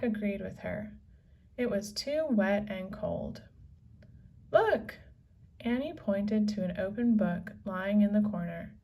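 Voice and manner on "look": sharp high cry of discovery; bright high exclaim; excited call. Acted. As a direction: point it out, thrilled. Annie spots the open book.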